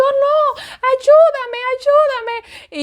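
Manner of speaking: high, strained cries for help; high panicked plea to her husband; desperate pleading. Retold or imitated. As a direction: beg him frantically, voice way up. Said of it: "y yo".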